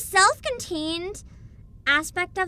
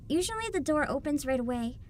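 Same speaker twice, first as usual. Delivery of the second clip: calm, even narrator voice; story narration; 2 semitones lower, 6 dB quieter than usual. A narrator's reading. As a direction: read it like a storybook. Reading game narration.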